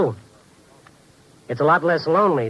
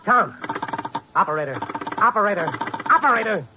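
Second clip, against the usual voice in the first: urgent calling; 8 semitones higher than usual. Acted. Randy calls out after the line dies.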